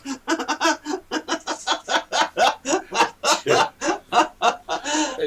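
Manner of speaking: long, high cackling laugh fit; helpless laughter. Real, not acted. Host losing it over John's mishap, helpless high laughter. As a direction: crack up and keep laughing, high and loud. Locked-out John sets off laughter.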